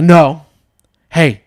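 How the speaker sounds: loud, sharp mimic of a cross wife; retold anger. Wife stops husband criticizing kids, retold anger. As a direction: imitate her shutting him down. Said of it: "She got so mad".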